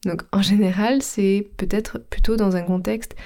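normal talking voice

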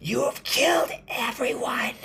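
very high, loud accusing scream; screaming witch. A narrator's reading. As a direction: screech the accusation at full force. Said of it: "she screamed".

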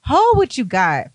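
slow, high, drawn-out "hold what you got"; sassy stop. Retold or imitated. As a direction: push him off with sass. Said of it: "I'm like".

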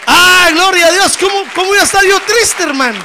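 high, loud cry of praise; joyful preaching shout. Real, not acted. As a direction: burst out rejoicing at full voice. Preacher exclaims joy to congregation.

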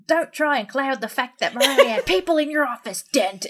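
imitating Gary Oldman, who starts British and ends overdone American on "Dent"; Gary Oldman overcorrected accent. Mimicking Gary Oldman's line for a friend, loud slipping accent. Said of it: "the way that he says it is".

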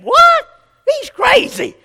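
very high, loud shriek of disbelief; outraged Kathy. Retold or imitated. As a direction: mimic an indignant wife, voice shooting way up. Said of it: "That's the way she talks".

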